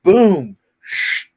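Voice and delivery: loud "boom" then an unvoiced "shh"; mock thud and scrape. Teller imitating a battery dragged up steps, thud then hiss.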